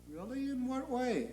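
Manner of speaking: soft, high, meek query; hushed, puzzled angel; Gabriel. Acted. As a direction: ask the boss timidly. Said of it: "And Gabriel says".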